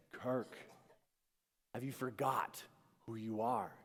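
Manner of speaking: soft, measured question; gently spaced phrases, voice held down; quiet inner voice. Retold or imitated. Inner voice saying it to Kirk, quiet.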